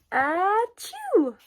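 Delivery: high, loud sneeze; a big sudden achoo. A narrator's reading. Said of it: "before he could cover his snoot".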